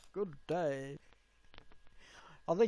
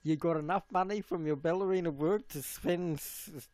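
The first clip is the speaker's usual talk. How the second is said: ordinary delivery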